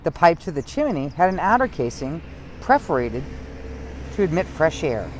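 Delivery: steady storytime read-aloud; story narration. Acted. Librarian reading a local history book to viewers in storytime voice. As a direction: read the history to the children, evenly.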